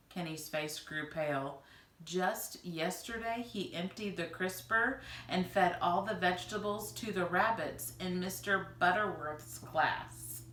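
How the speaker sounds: plain reading voice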